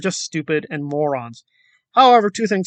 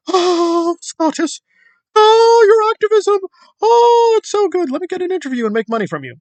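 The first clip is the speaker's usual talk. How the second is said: very high swooning mock voice, louder on each oh, then rushed; mocking Nora; 13 semitones higher, 4 dB louder than usual. Commentator parodying Nora fawning over Scottus, shrill swooning mock. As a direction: gush like a fake fan, then hurry the greedy part. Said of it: "She said".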